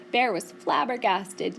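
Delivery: usual speaking voice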